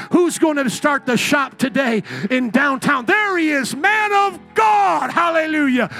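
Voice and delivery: loud, high, drawn-out preacher cry; preaching at full force. Real, not acted. Preacher crying out a blessing over the next businessman, loud and high. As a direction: lift the church with a shouted blessing.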